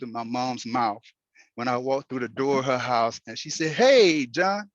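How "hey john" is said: warm greeting. Retold or imitated. Mom greeting him at door.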